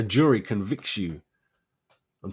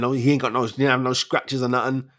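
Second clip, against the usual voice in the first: ordinary talk, nothing marked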